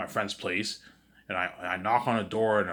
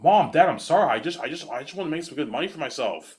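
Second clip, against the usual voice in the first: higher, louder pleading apology; 6 semitones higher, 6 dB louder than usual. Retold or imitated. Son apologizing to angry parents, raised pleading voice. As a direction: beg the parents for forgiveness.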